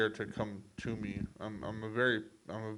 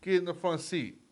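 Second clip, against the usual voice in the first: loud, high New York mobster; mock-menacing invitation in New York accent; 7 semitones higher, 7 dB louder than usual. Mobster luring someone into a car, put-on New York accent. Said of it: "you go to fucking New York".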